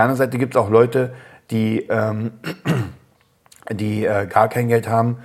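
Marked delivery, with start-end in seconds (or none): 1.5-5.2 hoarse, voice giving out mid-sentence. Real, not acted. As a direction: voice failing, throat catching. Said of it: "jetzt hat meine Stimme versagt tatsächlich".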